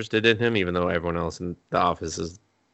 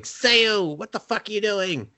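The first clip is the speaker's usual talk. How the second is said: high-pitched mock outburst; exasperated high-voiced imitation; retold alarmed scolding; 12 semitones higher than usual. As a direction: act out scolding her, pitched way up. Retold outburst on finding her.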